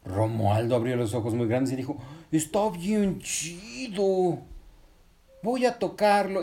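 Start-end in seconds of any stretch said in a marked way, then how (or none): 2.3-6.4 put-on drawn-out, higher-pitched boy's marvel; eager little boy voice; awed excitement. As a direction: slowly savour it, high and thrilled. Wide-eyed boy admires the caterpillar.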